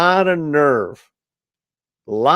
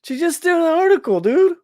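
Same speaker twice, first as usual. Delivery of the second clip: much higher, outraged retort; indignant; 13 semitones higher than usual. Real, not acted. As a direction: pitch it up in disbelief at the article. Cuts in, indignant about the article.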